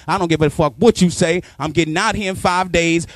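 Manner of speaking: normal talking voice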